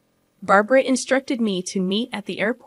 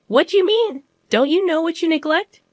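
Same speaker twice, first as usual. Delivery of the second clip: high, raised-voice challenge; indignant demand; 7 semitones higher, 4 dB louder than usual. Acted. Mother-in-law and wife quarrel over neglect, indignant. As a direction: throw the questions back, pitched well up.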